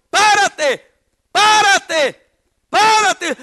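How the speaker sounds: "Párate" yelled loud and high, each one falling; shouted command. Retold or imitated. Friends on the beach shouting at a panicking youth, loud and high. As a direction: shout "Párate" across the water.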